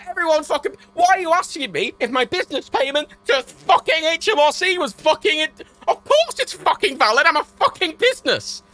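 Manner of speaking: high, loud exasperated rant; angry rant. Real, not acted. Ranting about HMRC payment check.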